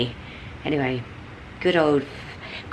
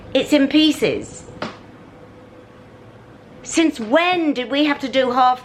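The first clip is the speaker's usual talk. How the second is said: exasperated; 7 semitones higher than usual. Real, not acted. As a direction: stretch it out in disbelief. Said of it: "I'm sorry I'm screeching".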